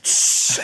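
short imitated aerosol burst; spray can hiss. Imitating beachgoers spraying on oil, voiceless hiss. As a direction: make a spraying noise through the teeth.

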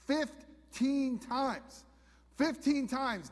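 Fifteen times stretched slow and high, then said again high; emphatic repeat. Real, not acted. Preacher to congregation, Fifteen drawn out and stressed. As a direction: lean hard on Fifteen, slow the first time.

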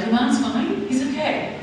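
a hint of a mother calming her daughter; mum reassuring.

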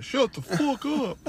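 high, drawn-out scolding; mock exasperation. Owner telling the barking dog off, mock exasperation. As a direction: drag out a high, slow rebuke.

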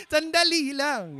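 high, tearful plea to wait, breaking into a laugh; high pleading voice cut by a laugh; crying churchgoer. Pretend. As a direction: play a sobbing listener begging for a moment. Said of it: "Huwag niyo pigilan yung naram".

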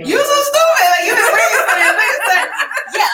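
high, loud laughing tease; cracking up while teasing. Real, not acted. Teases friend, laughing hard.

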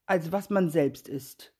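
ordinary delivery

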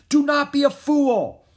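high, loud, drawn-out shout; yelling. A narrator's reading. King Philip scolds the prince from afar, yelling. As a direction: yell it slowly, hammering the order. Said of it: "King Philip yelled at the prince".

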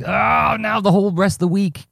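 high-pitched imitation of bubbling panic; mock panic. Retelling his inner reaction to a jumbled week, mock panicked. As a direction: re-enact the panic welling up. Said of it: "I felt the panic bubbling up".

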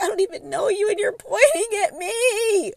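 put-on high, loud, tearful girl voice; upset girl. Retelling how the upset girl complained, in a high tearful whine. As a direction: whine it high like a hurt kid.

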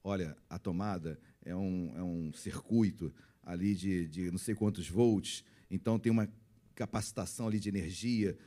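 mock patient explaining. Parent explaining a socket to a toddler, mock patient. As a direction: explain it carefully in a hushed low voice. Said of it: "como é que você conversa com ela".

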